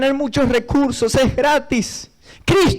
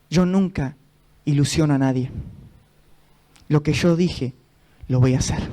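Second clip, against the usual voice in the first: low, measured divine reply; solemn, grave reassurance; the Holy Spirit; 8 semitones lower than usual. Retold or imitated. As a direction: answer gently and low, with pauses. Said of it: "Y el Espíritu Santo me habla".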